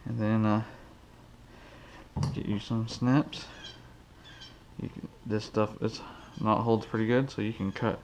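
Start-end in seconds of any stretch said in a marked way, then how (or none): none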